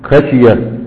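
ordinary talk, nothing marked

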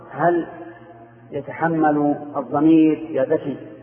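ordinary talk, nothing marked

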